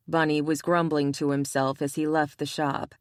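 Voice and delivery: plain reading voice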